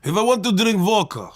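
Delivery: put-on Russian accent. Shown as a demonstration. Comic showing the host his Russian impression, in a Russian accent. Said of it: "when I do a Russian accent".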